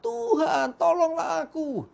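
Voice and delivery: high, urgent plea; cry for help. A narrator's reading. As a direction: call out while sinking. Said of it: "Lalu berteriak".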